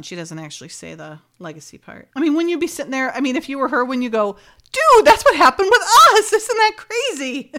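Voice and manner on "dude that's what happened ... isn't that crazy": loud, high-pitched mock astonishment; amazed outburst. Imagining her reaction to the coincidence, loud excited outburst. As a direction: exclaim it like a thrilled friend, far up in pitch.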